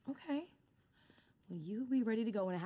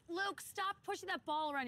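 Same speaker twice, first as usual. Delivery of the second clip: high-pitched warning call; parental scolding; 8 semitones higher than usual. Acted. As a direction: call sharply to your child. Parent warning child near water.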